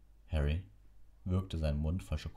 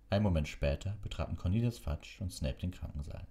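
ordinary delivery, nothing marked

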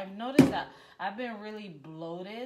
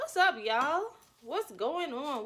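excited greeting; 8 semitones higher, 6 dB louder than usual. Real, not acted. Hyped greeting.

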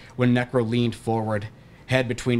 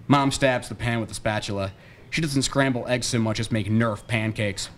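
plain reading voice

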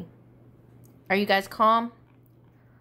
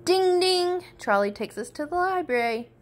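plain reading voice

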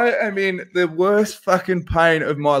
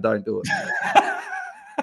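very high, slow, drawn-out giggly plea; warning listeners while cracking up; laughing warning; 6 dB quieter, much slower than usual. Real, not acted. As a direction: stretch it out laughing.

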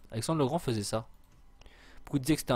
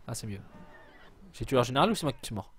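ordinary talk

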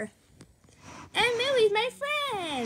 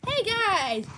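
normal talking voice

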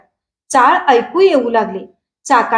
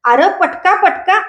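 high, urgent call-out; mild warning shout; 6 semitones higher than usual. A narrator's reading. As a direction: cry a warning to someone on the cart, pitched up. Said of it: "कोणतरी ओरडलं".

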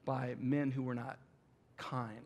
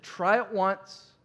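slow plea; 6 semitones higher, 8 dB louder, much slower than usual. Retold or imitated. Pastor urging Melissa.

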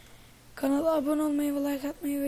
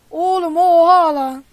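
loud, higher, slow stunned yell; astonished shout; 4 semitones higher, 16 dB louder, slower than usual. Real, not acted. Gamer hollering in shock at what just happened, astonished.

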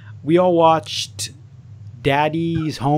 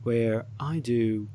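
mock host announcement; 9 dB quieter than usual. Guest filling in as host while she steps away, soft mock announcer. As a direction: play the stand-in host while she's gone.

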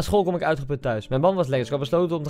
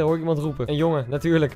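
plain reading voice

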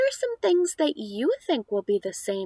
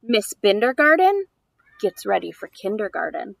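lively, louder picture-book reading; reading to children; 6 dB louder than usual. Acted. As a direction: read the rhymes aloud to a small child. Reads picture book aloud.

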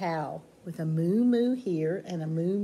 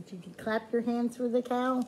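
ordinary talk

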